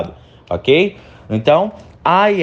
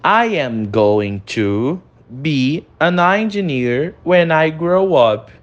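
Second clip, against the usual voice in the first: slow, careful "I am going to be an engineer when I grow up"; teacher modelling slowly; slow model reading; slower than usual. Shown as a demonstration. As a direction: read it slowly for the class. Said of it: "Primeiro nós temos".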